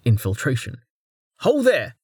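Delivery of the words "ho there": calling out. Acted. Man calls out to her.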